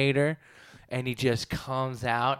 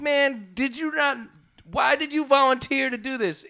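high, loud mock disbelief at the player; mock exasperated yell; 10 semitones higher, 4 dB louder than usual. Fan mock-yelling at the airballing player, high and loud. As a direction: yell at the guy who volunteered and blew it.

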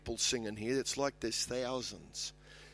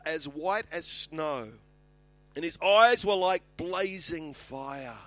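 raised-pitch Bible reading, slowing at the end; Scripture proclamation; 7 semitones higher, 5 dB louder than usual. Acted. Preacher describes John's vision of Jesus, proclaiming it. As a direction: proclaim the vision, then draw out the last images. Reads Scripture aloud to congregation.

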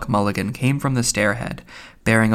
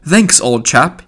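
louder, raised, brisk thanks; 4 semitones higher, 6 dB louder, slower than usual. A narrator's reading. Mulligan thanks an unseen helper after the whistles, brisk. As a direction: toss off thanks briskly, pitched up.

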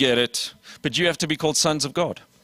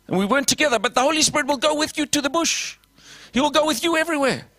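much higher, climbing preacher's fervor; fervent preaching; 9 semitones higher than usual. Acted. Preacher promising the Spirit to the church, rising fervently. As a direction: lift it up like revival is breaking out.

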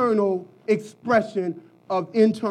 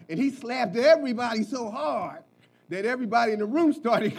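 ordinary talk

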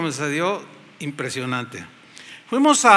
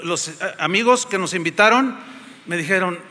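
usual speaking voice

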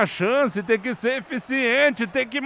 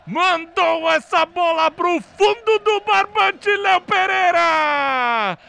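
high, loud celebratory recap; excited goal recap; 8 semitones higher, 4 dB louder than usual. Real, not acted. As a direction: relive the goal, shouting. Goal replay narration. (narração empolgada do gol)

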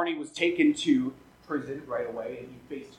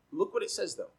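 normal talking voice

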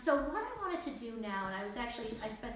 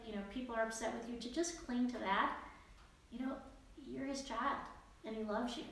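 normal talking voice

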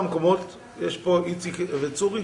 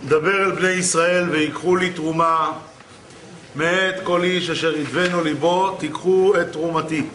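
scripture recitation. Real, not acted. Preacher quoting the Torah to listeners, solemnly recited. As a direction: declaim the verse deliberately, word by word.

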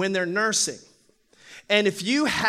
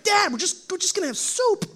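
retold protest to dad; 9 semitones higher than usual. Pleading with his high-energy father.

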